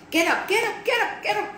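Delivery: high, insistent wake-up call; urgent crowing. A narrator's reading. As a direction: crow it out while jumping up and down. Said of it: "she crowed, jumping up and down".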